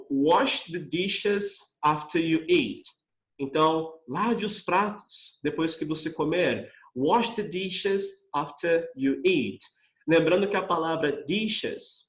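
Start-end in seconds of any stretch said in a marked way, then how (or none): 0.1-2.8 slow, careful English command; slow model reading. Shown as a demonstration. Teacher reading a command slowly. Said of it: "Segunda frase". 7.0-9.6 slow, careful English command; slow model reading. Shown as a demonstration. Teacher reading a command slowly. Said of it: "Segunda frase".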